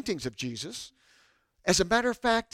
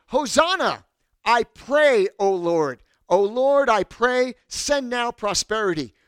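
loud, high fervent plea; crying out in prayer; 5 semitones higher, 7 dB louder than usual. Acted. Preacher reading psalm to congregation, crying out in prayer. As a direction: call up to the Lord, easing at the end.

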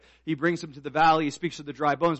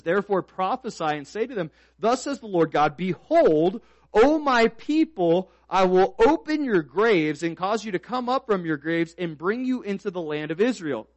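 loud, high pulpit declamation; prophetic proclamation; 5 semitones higher, 7 dB louder than usual. Real, not acted. Preacher proclaiming God's words to his people, prophetic proclamation. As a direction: proclaim it like a prophet, drawing out the address.

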